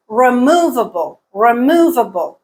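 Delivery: removable with MOV long and strong; second-syllable stress. Shown as a demonstration. Teacher showing learners word stress, removable stressed on MOV. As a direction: lean hard on MOV in removable, then fall away. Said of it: "Mu is the stressed syllable".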